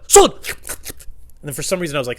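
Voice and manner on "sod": loud, high one-word bursts; odd store customer. Retold or imitated. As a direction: blurt it high like a baffling customer. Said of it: "this guy came up to me".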